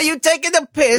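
high puppety attempt at British; bad British accent. Puppet proving he can sound British, high mock-British accent. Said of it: "it sounded like a puppet talking about taking a piss".

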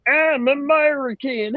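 high, slow mock Southern American accent; bad Southern accent. British people imitating Americans, high exaggerated Southern accent. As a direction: Brit badly faking a Southern American accent. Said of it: "British people when they're like".

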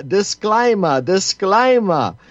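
high, drawn-out mock Australian accent; Sean Surplus Australian accent. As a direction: ham up the Australian accent on the repeated word. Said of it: "in my best Sean Surplus Australian accent".

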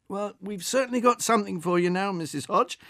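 professional good cheer. Acted. Delivery man coaxing the old woman, with professional good cheer. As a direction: keep it chirpy and patient.

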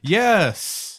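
high, sudden sports cheer; mock cheer. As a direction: yell it like a goal was just scored. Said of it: "you go, you go".

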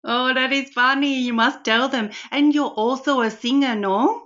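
a hammed-up Indian auntie accent, full of darlings; Rani's Indian accent. Host plays Rani greeting Karen, in a playful put-on Indian accent. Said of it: "I've got Rani sitting next to me".

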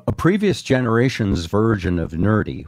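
ordinary talk

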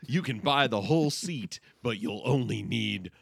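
slow, booming event ad announcer. Pretend. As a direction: sell it like a monster truck ad. Mock event ad guess.

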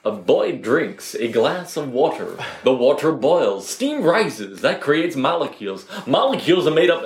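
imitating an old American science slide voice, slow at first; light mock American educational film voice; mock American narrator. Recalling school slides in a mock American voice. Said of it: "the American accent".

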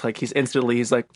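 ordinary delivery

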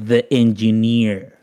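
slow, self-important English title; pompous, drawn-out the engineer; grand title. Pretend. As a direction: savour the words the engineer like a title of honour. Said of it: "me vienen hablando así".

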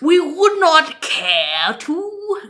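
very slow, mannered politeness; mock-genteel, lingering over each word; polite refusal. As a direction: draw out a hammed-up courteous refusal. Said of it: "Canada starts politely".

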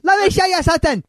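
very high manic cartoon voice with a cackle; hammed-up sketch-character impression; Fire Marshal Bill. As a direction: squeal it, then cackle. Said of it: "pull out my fire marshal bill".

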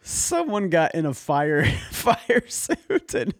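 high, helpless laughter through the words; laughing. Real, not acted. Co-host repeating the fire suit analogy back, laughing hard. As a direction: crack up while repeating the joke.

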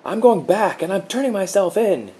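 very high, loud defiant yell. A narrator's reading. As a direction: shout it, determined. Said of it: "he yelled".

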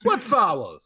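put-on brief Russian-accented jab at a referee. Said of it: "like, a Russian accent".

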